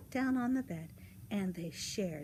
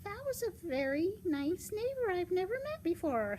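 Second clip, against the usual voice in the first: put-on high little-girl voice; sweet high storybook child; 9 semitones higher than usual. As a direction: play a naive, pleased girl, pitched high.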